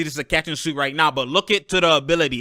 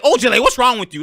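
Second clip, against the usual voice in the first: higher, louder mock coach snapping at his player; exasperated coach; 8 semitones higher, 6 dB louder than usual. Coach Brad Stevens scolding Ojale, exasperated. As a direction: play the fed-up coach, pitched up. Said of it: "You can see Brad Stevens like".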